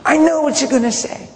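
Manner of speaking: high, heated imitation of a girl cutting in; flipping out. Girl cuts off her pastor mid-counsel, imitated high, flipping out. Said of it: "she just flips out on me".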